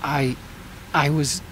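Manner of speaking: halting, broken-off start; faltering confession. Acted. Brother asked what he was doing, faltering confession. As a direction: begin to confess, then stall between words.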